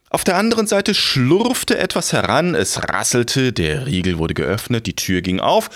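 plain reading voice

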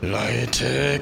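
strained, drawn-out growl; growling giant. A narrator's reading. The giant at his limit warns the people, growling. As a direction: growl through barely held nerves. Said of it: "knurrte der Riese".

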